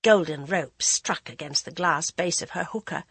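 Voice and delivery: ordinary delivery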